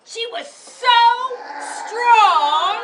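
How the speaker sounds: big, loud declaration of strength; storyteller booming Emily's strength; dramatic proclamation. A narrator's reading. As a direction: boom it out for the class.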